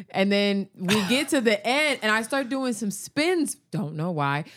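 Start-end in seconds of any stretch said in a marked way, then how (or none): none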